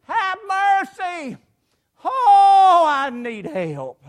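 high, loud, slow wail for help; crying out. Acted. Unseeing beggar cries to the wind, wailing. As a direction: wail it out, huge on the "oh". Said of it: "cry out to the wind".

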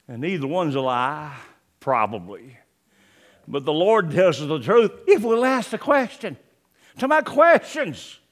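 ordinary delivery

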